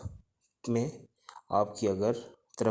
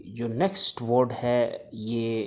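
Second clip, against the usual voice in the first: ordinary talk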